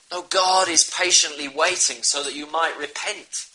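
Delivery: usual speaking voice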